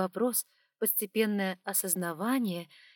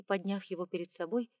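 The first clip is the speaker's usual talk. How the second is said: ordinary delivery, nothing marked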